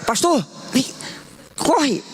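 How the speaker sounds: high, urgent call to the pastor; excited call. Retold or imitated. Calling the pastor over in excitement, high and urgent. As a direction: shout for him to come quick.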